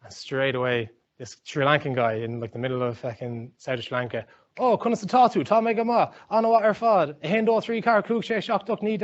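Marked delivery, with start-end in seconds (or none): 4.6-9.0 high, excited stream of imitated Irish gibberish; mock Irish from a street seller. Street seller greeting Irish tourists, gushing high mock Irish. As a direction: rattle off fake Irish eagerly, much higher. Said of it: "he started speaking Irish to us".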